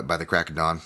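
ordinary delivery

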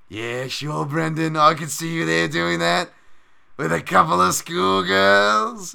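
teasing English pundit, slowing on the last words; Bisping voice. Retold or imitated. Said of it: "He was just like".

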